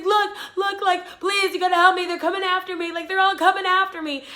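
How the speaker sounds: high, loud frantic begging; imitated desperate plea. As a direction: plead as if being chased. Imitates frantic man pleading.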